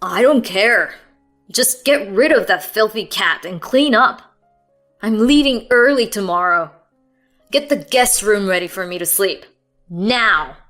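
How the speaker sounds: loud, angry commands; furious orders. A narrator's reading. Husband ordering wife to clean up, loud and angry. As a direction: bark every order.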